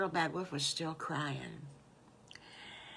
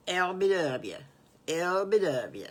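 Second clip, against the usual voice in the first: louder, drawn-out Southern letters; Southern accent; 7 dB louder than usual. Acted. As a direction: lay on a slight Southern drawl. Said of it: "that's really Southern, folks".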